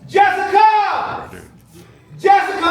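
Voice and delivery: high, loud, slow calling out; shouting a name. Retold or imitated. Teen boy calling a girl across the bleachers, loud. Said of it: "I was like".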